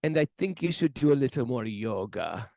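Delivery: imitated German swami giving advice; measured German-accented counsel. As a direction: put the German accent back on. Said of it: "and he just goes".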